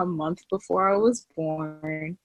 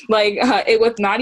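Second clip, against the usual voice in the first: laughing; 4 semitones higher, 6 dB louder than usual. Real, not acted. Laughing while recalling being poor.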